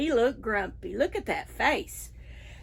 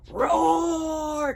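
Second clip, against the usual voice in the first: put-on a shouted, playful lion roar for the kids; pretend lion roar; 5 semitones higher than usual. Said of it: "Can you roar with me?".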